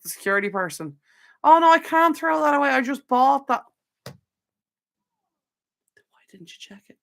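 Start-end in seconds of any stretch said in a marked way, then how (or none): 1.4-3.6 high, loud whiny traveller; whiny passenger. Retold or imitated. Mimicking passenger arguing at security. 4.1-7.0 hushed whispered aside. Retold or imitated. Muttered aside at the passenger.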